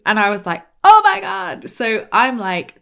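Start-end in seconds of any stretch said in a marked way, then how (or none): 0.8-1.7 high, loud star-struck squeal of words; retold thrilled outburst, pitched way up; excited disbelief. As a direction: burst out, re-enacting being picked. Retells reaction to being picked.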